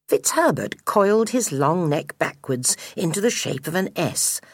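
ordinary delivery

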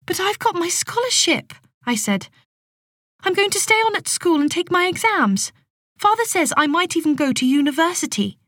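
high, urgent protest. A narrator's reading. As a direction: plead your case, pitched high. Opal protests going to factory.

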